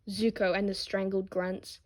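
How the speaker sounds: ordinary delivery, nothing marked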